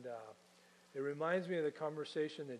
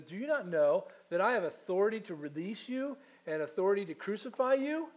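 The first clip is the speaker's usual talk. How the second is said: louder, much higher, rising anger; angry ruler; 5 semitones higher, 5 dB louder than usual. Acted. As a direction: threaten him, voice climbing. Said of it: "Pilate is getting angry with Jesus".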